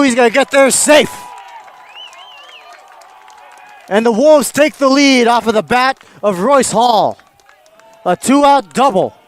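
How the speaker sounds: high, loud, rising call of the hit; excited play-by-play. Real, not acted.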